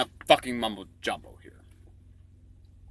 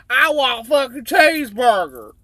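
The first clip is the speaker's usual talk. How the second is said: much higher, loud shouted woman's demand; patient on the operating table yelling for a burger, much higher and louder; 12 semitones higher, 7 dB louder than usual. Acted. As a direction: shriek it high like a greedy patient. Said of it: "She says".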